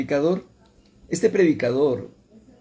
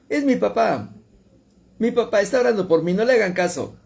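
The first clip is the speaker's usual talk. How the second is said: scoffing son, pitched higher; mocking; 6 semitones higher than usual. Drunk son mocking his praying father. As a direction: brush it off with a sneer. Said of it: "Como burlándose".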